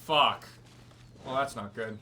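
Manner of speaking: ordinary talk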